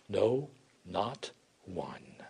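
very slow, low and hushed; hushed verdict. Acted. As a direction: let each word drop softly, with pauses. Solemn quoted verdict of Jesus.

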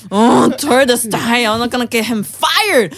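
loud, high, drawn-out exasperation; retold furious vow. Vowing on the airport trip, furious. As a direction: build to a shouted vow of revenge.

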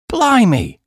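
sudden high-pitched Blimey; surprised exclamation. Acted. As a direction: blurt it out in amazement. Duncan exclaims at honey price.

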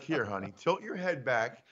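quiet wife calming her grumpy husband with a drink; soothing wife. Pretend. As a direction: hush him like a nurse with medicine. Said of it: "be like".

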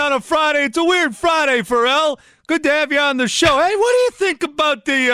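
high, bright radio host voice; Bill Michaels impression. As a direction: play it like a peppy radio host. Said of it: "that's not Bill Michaels, is it?".

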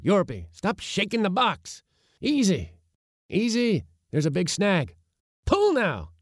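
high, urgent boatman's commands; calling out orders. A narrator's reading. Uncle directing his crew as they push off, calling out. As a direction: call out excitedly across the boat.

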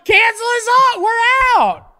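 hammed-up high cry quitting the show; mock farewell. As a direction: announce the end like a ham actor. Mock dramatic podcast sign-off.

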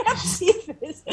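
rapid words through a laugh; laughing disbelief. Retold or imitated. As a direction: laugh it out, can't believe it. Disbelief at being slapped again. (rindo, sem acreditar, falando rápido)

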